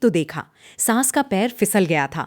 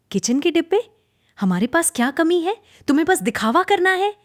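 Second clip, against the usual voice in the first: scornful disbelief; 10 semitones higher than usual. A narrator's reading. Mocking disbelief at kitchen boxes.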